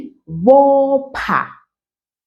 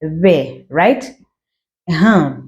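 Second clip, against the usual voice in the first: normal talking voice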